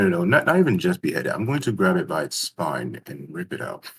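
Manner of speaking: usual speaking voice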